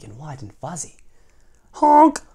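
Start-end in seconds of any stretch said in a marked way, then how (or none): 1.7-2.2 a big, high, loud honk; loud duckling honk. A narrator's reading. A new duckling honks to be liked, loud and high. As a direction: honk out loud like a gawky baby bird. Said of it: "said the new duckling".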